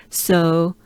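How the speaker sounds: usual speaking voice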